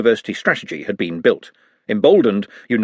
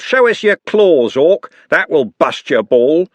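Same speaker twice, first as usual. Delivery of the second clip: loud, slow jeering taunt; taunting thug; 5 semitones higher, 5 dB louder, slower than usual. Acted. Andy jeers at the orc goalkeeper, loud slow taunts. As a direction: sneer it out to rattle the keeper.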